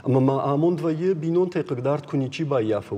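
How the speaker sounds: ordinary delivery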